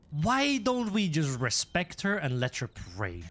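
usual speaking voice

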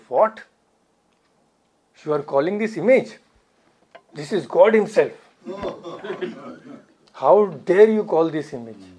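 retold indignation, quick and emphatic; indignant rebuke. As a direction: imitate a scandalized devotee scolding someone. Acting out an indignant devotee's rebuke.

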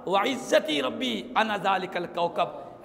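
usual speaking voice

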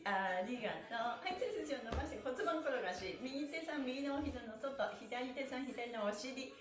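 usual speaking voice

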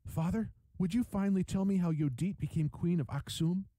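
put-on high-pitched boyish request; young boy. A narrator's reading. Son begs father for the queen's story, high and boyish.

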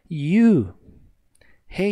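normal talking voice

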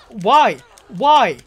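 loud high despairing why; outraged cry. Real, not acted. Gamer getting killed, loud outraged cry. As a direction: wail it at the screen.

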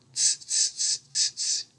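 very soft hissed "tss" noises; monkeys' tss-ing. A narrator's reading. Monkeys stomping their feet back at him, soft tss-ing. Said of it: "and said".

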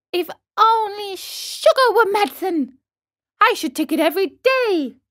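put-on high, childlike relish; delighted puppet boy. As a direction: savour it in a little boy's voice.

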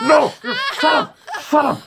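high-pitched pretend outburst; mock furious command. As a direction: snap in mock exasperation at a screaming victim. Silencing pretend screaming giraffe.

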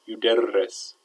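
"Yuderris" with the middle r flapped and held; doubled r. Shown as a demonstration. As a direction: lean on the rr inside "Yuderris". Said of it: "shadda on the ra".

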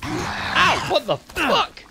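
high, loud pained yelps; startled shouting. Real, not acted. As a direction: yell at the attacker. Attacked by monster.

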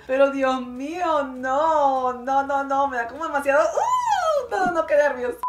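loud rising panic, pitch jumping on uh; nervous loud alarm. Real, not acted. Vlogger panicking loudly at a nail being cut. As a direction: squirm and cry out at the screen.

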